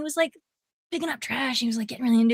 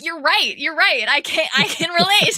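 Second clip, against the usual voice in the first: higher, louder amused concession; mock-delighted, raised voice agreeing; imitated amused agreement; 6 semitones higher, 6 dB louder than usual. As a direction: play a guy tickled into agreeing. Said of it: "They think it's so fucking funny".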